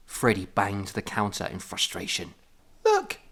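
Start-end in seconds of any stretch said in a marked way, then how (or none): 2.8-3.2 very high, loud cartoon fox; Tails voice. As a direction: squeak it out like Tails. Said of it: "Sounded like Tails from".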